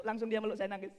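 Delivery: ordinary talk, nothing marked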